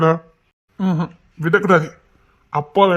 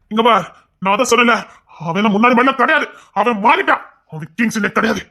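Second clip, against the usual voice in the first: ordinary delivery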